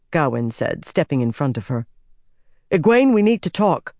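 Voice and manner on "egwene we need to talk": raised, louder urgent demand; pressing, higher and forceful; urgent insistence. Acted. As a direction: insist on being heard. Insists on talking, blocking her way.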